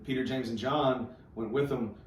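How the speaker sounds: usual speaking voice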